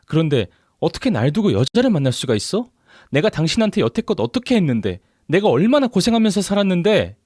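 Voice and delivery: high-pitched, wounded outrage; upset and angry. A narrator's reading. Betrayed wife to her husband, upset and angry. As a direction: plead angrily, climbing in pitch. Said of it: "너무 화가 나고 짜증나서 눈물이 나더라고요".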